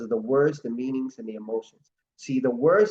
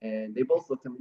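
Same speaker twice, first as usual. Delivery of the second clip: ordinary talk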